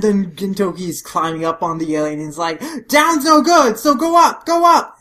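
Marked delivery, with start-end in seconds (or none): 2.9-5.0 very high, loud command; urgent yelling. Acted. Gintoki climbing on the alien, yelling urgently. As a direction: urge them upward in a hurry.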